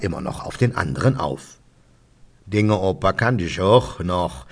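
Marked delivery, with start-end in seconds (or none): 2.5-4.5 put-on slow Kölsch old-timer; old undertaker. Old undertaker to a neighbour in the pub, slow dialect voice. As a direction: play a chummy old local in dialect, drawn out. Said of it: "sagt der alte Bestatter Herbert Franke".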